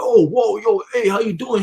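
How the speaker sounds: reply as if caught off guard; startled greeting. Retold or imitated. Everybody answering the greeting, startled.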